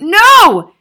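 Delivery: very loud, high cry of denial; anguished shout. A narrator's reading. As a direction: burst out in horrified disbelief. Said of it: "she cried".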